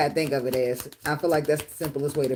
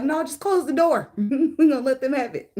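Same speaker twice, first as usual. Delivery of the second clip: laughing surrender; 9 semitones higher, 3 dB louder than usual. Retold or imitated. Giving up to huge mosquitoes, high and laughing. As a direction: throw up your hands, giggling. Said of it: "I was like".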